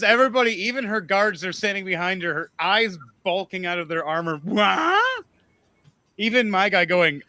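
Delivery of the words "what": shocked guard. Acted.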